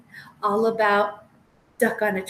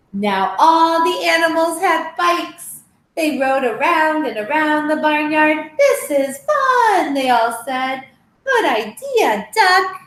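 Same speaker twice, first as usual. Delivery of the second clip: lively storybook read-aloud with voiced animals; reading to children; 4 semitones higher, 7 dB louder than usual. Teacher reading a picture book to children, lively. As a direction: tell the tale brightly to little listeners.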